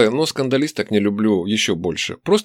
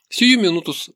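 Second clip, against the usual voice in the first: higher, ingratiating waiter; обольстительно; 7 semitones higher than usual. A narrator's reading. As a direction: charm the customer with eager service. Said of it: "проговорил официант обольстительно". (обольстительно, услужливо)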